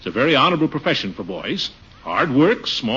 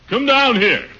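ordinary delivery

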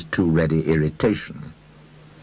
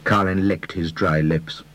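ordinary delivery

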